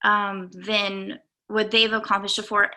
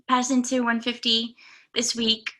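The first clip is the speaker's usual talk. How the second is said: ordinary talk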